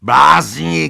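furious donkey shouting at the animals; angry shout. A narrator's reading. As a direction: yell it at the fools. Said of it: "křičel Benjamin".

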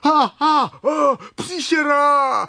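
high, loud shriek of fright; terrified shouting. A narrator's reading. Brunda spots the ugly water scorpion, screaming. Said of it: "křičel Brunda".

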